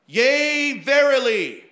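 loud, high proclaiming; mock prophetic declaration; bold declaration. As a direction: boom it out like a prophecy. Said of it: "declaring boldly these, this phrase".

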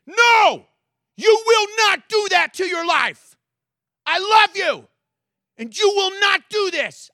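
high, loud, forceful plea; a parent fighting for a child, loud and high; fierce stand. Acted. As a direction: plant your feet and refuse, full force. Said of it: "stand toe-to-toe with the rebellion and say".

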